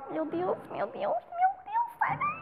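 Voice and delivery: very high panicked shriek; panicked squeal. Real, not acted.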